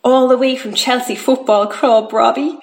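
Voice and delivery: plain reading voice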